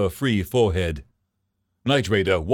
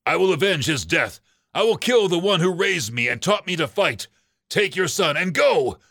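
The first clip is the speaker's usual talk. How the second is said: a lightly raised vow of revenge; a hint of a father swearing vengeance, a bit higher; vengeful grieving father; 7 semitones higher than usual.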